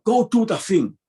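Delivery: put-on high, loud Tongan-accented dad voice; imitated Tongan-accented broken English. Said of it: "You know, in Tongan".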